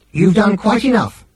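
high, put-on machine voice; Nutrimatic machine. A narrator's reading. Machine refusing Arthur's help, high curt voice. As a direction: rebuff him in a high machine voice.

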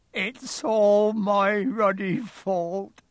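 drawn-out, high, loud acted wail; sobbing. A narrator's reading. Hagrid blames himself to Harry, acted sobbing. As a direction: sob it out slowly in self-blame. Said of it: "he sobbed".

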